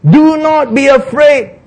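high, slow, ringing proclamation; confident, drawn-out command; confident Moses. Acted. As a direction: declare it boldly to a frightened crowd.

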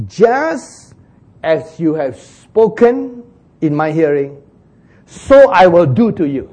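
the Lord answering the complainers, stern; solemn divine verdict. Acted. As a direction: pronounce it like a judge from heaven. The Lord pronouncing judgement.

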